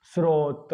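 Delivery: स्रोत with s straight into r: "srot"; स्रोत with clean "sr" start; correct pronunciation. Shown as a demonstration. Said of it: "पहले इसको ठीक बोलें".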